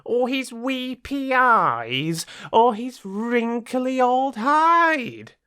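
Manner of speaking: a cocky little rat puffing himself up; proud little rat. Acted. Proud rat's boastful speech.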